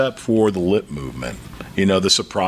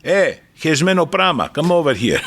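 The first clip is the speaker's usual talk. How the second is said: Greek grandfather; 7 semitones higher than usual. Retold or imitated. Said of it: "And then you might, "Papu".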